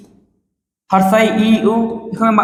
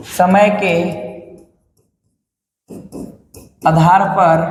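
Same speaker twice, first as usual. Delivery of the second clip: word by word, long pauses between; slow dictation; 2 semitones lower, slower than usual. Real, not acted. Teacher dictating notes for students to write, slow dictation. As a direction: dictate in separate, firm chunks.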